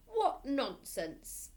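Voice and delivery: slow, loud, scornful; scornful scoff. Acted. Headmistress dismissing the boy's claim, slow and scornful. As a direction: drag it out with contempt.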